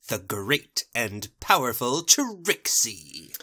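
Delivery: slow, drawn-out showman proclamation; grandiose boast. Acted. Magician announcing herself, slow and grandiose. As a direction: proclaim the title, stretching every word.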